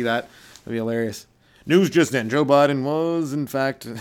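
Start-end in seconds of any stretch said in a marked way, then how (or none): 1.7-3.9 louder, slow announcer read; hammed-up breaking-news voice; mock news bulletin. Comic playing a newsreader announcing Biden's capture, mock announcer.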